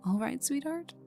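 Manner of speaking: high, lilting tease; teasing sweet-talk. Acted. Needling a nervous partner before the job, teasing sweet-talk. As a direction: lift it into a playful question.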